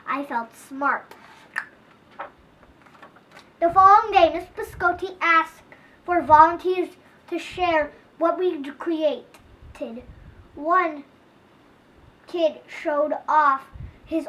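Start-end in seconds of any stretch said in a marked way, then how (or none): none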